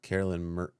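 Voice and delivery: usual speaking voice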